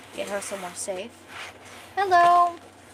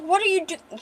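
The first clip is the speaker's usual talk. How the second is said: high-pitched, cut-off indignant question; mad; 7 semitones higher than usual. Acted. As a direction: start to confront him, pitched up. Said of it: "She's mad".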